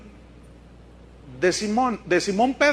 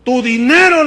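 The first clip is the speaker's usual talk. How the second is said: "Tu dinero" said louder, forceful and grave; Pedro's real stern rebuke, louder; 2 semitones higher, 8 dB louder than usual. Acted. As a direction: thunder the apostle's rebuke. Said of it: "le dice".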